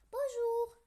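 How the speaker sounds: high, loud polite greeting from a bear. Acted. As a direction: greet them sweetly and loudly. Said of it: "dit-il très poli".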